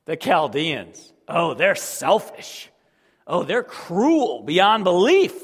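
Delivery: slow and loud, rising in force; slow building shout. Retold or imitated. Preacher as God denouncing the Chaldeans, slow shout. God's warning in sermon.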